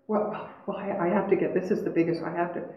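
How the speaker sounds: soft, halting retold stammer; low, hushed false starts; flustered patient. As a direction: imitate a patient fumbling for words.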